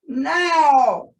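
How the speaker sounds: sudden loud high cry; the sly fox shouting the go signal; shouted command. Acted. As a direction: yell it out as the signal to jump. Fox signals bear to jump.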